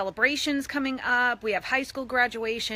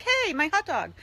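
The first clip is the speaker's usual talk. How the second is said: dog voice; 7 semitones higher than usual. Pretend. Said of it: "Aspen's looking at it like".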